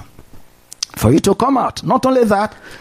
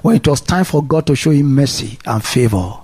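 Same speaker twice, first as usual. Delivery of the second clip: normal talking voice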